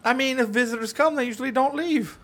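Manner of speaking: a hammed-up Canadian from up north; mock Canadian accent. Telling Justin where he lives, in a mock Canadian accent.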